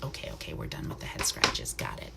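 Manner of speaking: soft whisper to the owl; whispered aside to bird. Real, not acted. Keeper whispers to the owl scratching her head. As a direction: whisper it to the bird on your glove.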